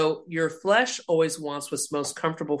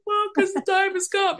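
own tearful reply. Retold or imitated. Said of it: "I go".

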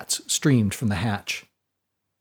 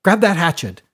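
urgent order; 6 semitones higher, 4 dB louder than usual. A narrator's reading. Fiona orders Brody.

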